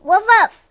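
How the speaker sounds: high bouncy woof woof; playful dog bark. Pretend. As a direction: bark like a happy puppy. Dog barking in picture book.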